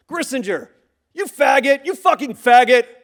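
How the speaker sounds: loud jeering slur from a car; mocking taunt. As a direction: jeer at him from the car window. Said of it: "And I hear".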